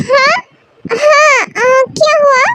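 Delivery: ordinary delivery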